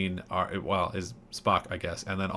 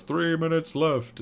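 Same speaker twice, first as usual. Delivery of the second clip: higher, slow eerie alien voice; creepy alien countdown; 7 semitones higher, slower than usual. Retold or imitated. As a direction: announce the doom slowly like a puppet alien. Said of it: "Squidward goes".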